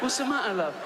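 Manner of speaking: high-pitched mock-worried question; feigned innocent concern. Comic pretending to help the woman he is poisoning, mock concern.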